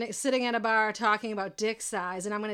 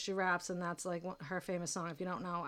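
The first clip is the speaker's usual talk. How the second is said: ordinary delivery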